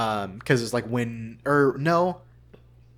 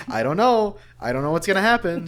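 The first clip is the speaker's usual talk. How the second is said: mock-innocent, much higher and louder; coy denial; 9 semitones higher, 8 dB louder than usual. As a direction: play dumb teasingly, as if you know nothing. Teasing wife, playing innocent.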